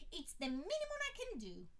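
delighted to oblige, voice soaring; eager admirer. A narrator's reading. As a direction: fan offering his place, high and eager. Man insisting.

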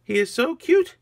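high, loud cooing over cuteness; gushing delight. Acted. Agnes admiring the little minion, high gushing.

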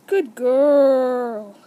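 loud sing-out praise for an animal; drawn-out praise. Real, not acted. Praising guinea pig during training.

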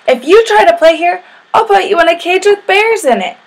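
high, loud bully's threat; yelled threat. A narrator's reading. Sammy threatens Kevin in the sandbox, yelling. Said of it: "Sammy yelled, even louder".

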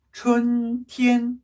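"춘티엔" very slow, two level held notes; double first tone. Shown as a demonstration. Teacher drilling spring, "춘티엔" slow and level. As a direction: chant "춘" and "티엔" steadily on one pitch. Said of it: "이게 일 성, 일 성이에요".

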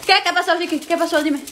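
ordinary talk, nothing marked

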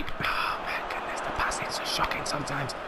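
soft unvoiced whisper; real despairing whisper. Real, not acted. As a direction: breathe it out in dismay. Dismayed at bad passing.

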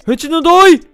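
goal celebration. Real, not acted. Celebrating his own goal, loud triumphant cheer.

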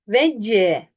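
"Vec" with Turkish v and a trailing vowel; wrong Turkish-style reading. Shown as a demonstration. Teacher shows the mistake, Turkish-sounding "Vec". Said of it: "Yanlış okuma şu an".

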